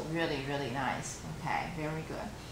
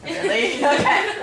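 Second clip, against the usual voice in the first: laughing; 8 semitones higher, 8 dB louder than usual. Real, not acted. Laughs at her own flat demo.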